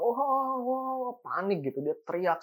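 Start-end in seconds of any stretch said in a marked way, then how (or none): 0.0-1.1 high, startled mock cry of fright; retold scream. Grandmother sees a ghost in the corner, high frightened cry. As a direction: yelp it out like a scared old woman. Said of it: "dia langsung teriak".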